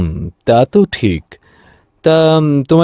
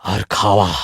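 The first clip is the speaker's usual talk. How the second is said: softer, weary sigh of a father; dejected; 8 semitones lower, 4 dB quieter than usual. Acted. Father telling his wife he has no appetite, dejected. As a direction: let the disappointment weigh.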